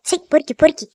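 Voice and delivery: contemptuous, repeated name-calling; disgusted scolding. Acted. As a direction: spit out the insult in disgust. Girl scolds man who kissed her.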